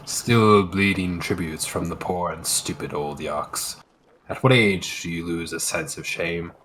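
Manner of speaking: low, rough warrior voice; mock an old rival, gruffly; gruff Viking voice. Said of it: "I was in character".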